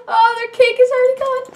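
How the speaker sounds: fast, high, giddy from laughing; quick high-pitched gloating; high, giddy repeat. Real, not acted. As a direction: squeal it out through the giggles. Repeats it after laughing fit.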